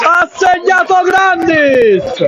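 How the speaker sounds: rising to a roaring goal call; breathless italian goal commentary. Real, not acted. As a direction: scream the goal, stretch the name. Goal scored, commentator erupts.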